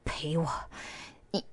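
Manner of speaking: 气若游丝的耳语; 受伤后吃力地挤出声音. A narrator's reading. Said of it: "艰难地说". (艰难地气声说)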